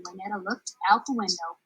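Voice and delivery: ordinary delivery, nothing marked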